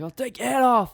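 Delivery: high, loud mock threat; retold furious yell in a high put-on voice; imitated angry yell. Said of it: "he was yelling at me".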